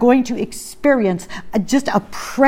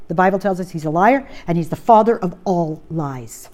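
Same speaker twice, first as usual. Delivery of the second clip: ordinary talk, nothing marked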